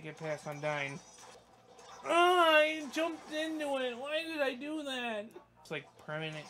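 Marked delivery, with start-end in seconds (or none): 2.0-5.3 upset cry. Real, not acted. Dies by jumping in.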